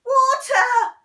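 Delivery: very loud, high gasp; Henry choking, crying out for water. Acted. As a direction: choke out the cry. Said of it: "he gasped".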